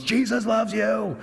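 high, drawn-out imitation yell; retold full-lung shout. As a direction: belt it out slowly, as if into a mailbox. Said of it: "shouted at the top of his lungs".

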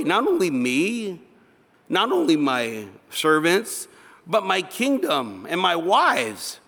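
high, drawn-out incredulous scolding; indignant Abimelech. Acted. Unbeliever telling the believer off for this wicked thing, indignant. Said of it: "is telling the believer".

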